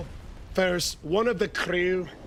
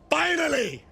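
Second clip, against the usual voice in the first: excited shout; 9 semitones higher than usual. Real, not acted. Relieved hype shout.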